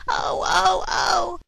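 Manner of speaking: high, loud wailing cry; crying scream. A narrator's reading. Puppet boy wailing to father about his feet, loud high crying. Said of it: "began to scream and cry so loudly".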